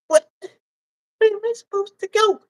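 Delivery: fast high plea; high vagina voice. Acted. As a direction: beg in a squeaky voice. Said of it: "Anywhere else with that voice".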